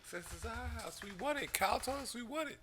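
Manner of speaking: lightly mocking intruders; trespassers. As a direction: whine it like the intruders. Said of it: "voicing the trespassers".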